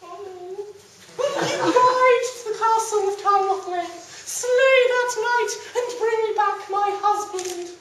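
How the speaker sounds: high, loud distressed noblewoman voice; high pleading damsel; pleading lady. Acted. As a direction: beg the king as a wronged lady. Disguised lady pleads with Arthur.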